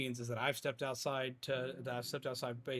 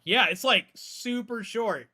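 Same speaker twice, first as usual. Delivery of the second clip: excited; 9 semitones higher, 10 dB louder, slower than usual. Real, not acted. Excited reaction, loud high.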